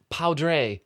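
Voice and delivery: slow "Poudre" with an OW in it; deliberately wrong pronunciation. Shown as a demonstration. Co-host joins the guessing, mispronouncing "Poudre" as slow POW-dray. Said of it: "let me pronounce it wrong as well".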